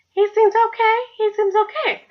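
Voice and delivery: high-pitched little verdict, held up high; approving aside. Retold or imitated. Girl sizing up the guy by the car, high-pitched approving aside. Voices her thought on the guy.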